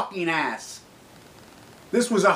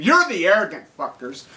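usual speaking voice

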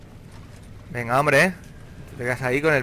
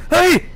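short, very high, loud yelp; startled yelp; 10 dB louder than usual. Real, not acted. Player given a fright by the game, startled yelp. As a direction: yelp sharply in sudden fright.

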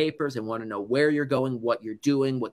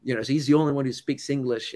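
usual speaking voice

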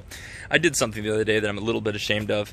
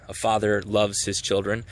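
ordinary talk

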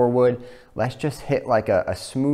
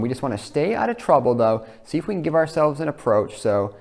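ordinary talk, nothing marked

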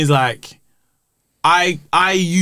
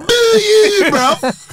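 high, loud jeering boo; loud mock jeer at booing fans; mock boo back; 13 semitones higher, 5 dB louder, much slower than usual. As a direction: boo the crowd right back. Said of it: "I'm like".

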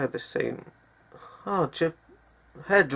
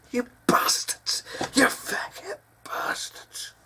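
strained curse; 9 semitones higher than usual. Real, not acted. Cursing bombers after news item.

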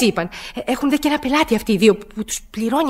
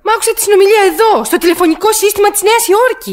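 agitated insistence; 7 semitones higher than usual. Acted. Insisting against policeman's doubt.

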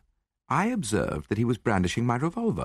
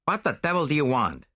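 higher, harsh challenge at the door; harsh demand; 6 semitones higher than usual. A narrator's reading. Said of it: "a man's voice demanded harshly".

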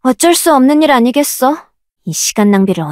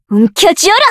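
pitched up, rising end; higher forceful command; 6 semitones higher than usual. Acted. Summoner commanding a creature, higher and forceful. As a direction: fire the order off.

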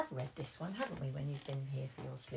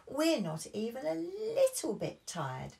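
high slow defiant protest; high childish insistence; excited protest; 10 semitones higher, 5 dB louder, slower than usual. Acted. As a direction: cry out not tired. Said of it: "cried Peppa".

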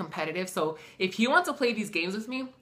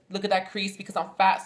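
usual speaking voice

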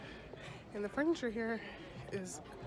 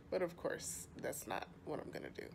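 soft whispered aside; whispering; 6 semitones lower, 11 dB quieter than usual. Real, not acted. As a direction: whisper it to yourself.

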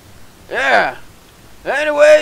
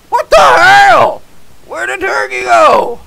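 much higher, loud shocked yell; shocked shout; 8 semitones higher, 7 dB louder than usual. Real, not acted. Gamer finding the turkey gone, loud shocked shout. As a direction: yell it out in disbelief.

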